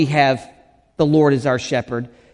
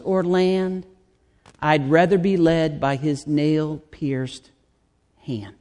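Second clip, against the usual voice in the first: hymn recitation. Acted. Pastor reciting an old hymn to open the sermon, reverently. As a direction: let the hymn words settle gently.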